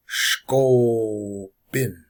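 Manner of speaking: slow SHKO, a pause, then "bin"; drawn-out demonstration. Shown as a demonstration. Teacher breaking a word down for learners, slow and drawn out. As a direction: stretch out "Shko", then set "bin" apart. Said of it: "they should be said real quick".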